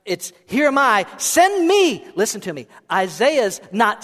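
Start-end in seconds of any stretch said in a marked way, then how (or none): 0.5-2.0 loud, high, urgent exclamation; forceful cry of an eager volunteer; emphatic. Acted. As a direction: shout it with an exclamation point. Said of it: "Isaiah's response is emphatic".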